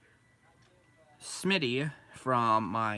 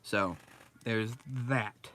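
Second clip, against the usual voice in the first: ordinary talk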